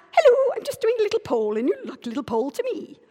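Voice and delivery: high, quick comic pollster voice; Victoria Wood. Acted. Doing a comedy survey-taker greeting a passer-by, high comic voice. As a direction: chirp it high like a sketch character. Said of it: "Victoria Wood. You remember that?".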